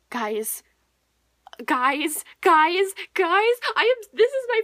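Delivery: building from plain to a loud high call; excited calling out. Real, not acted. Booktuber calling her viewers, building to an excited yell.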